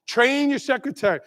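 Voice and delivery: normal talking voice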